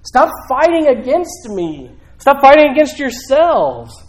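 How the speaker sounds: high, drawn-out preacher's cry; impassioned imitation of a divine yell; yelling plea. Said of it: "is yelling to us".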